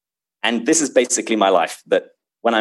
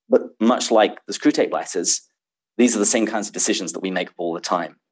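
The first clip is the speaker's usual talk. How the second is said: ordinary talk, nothing marked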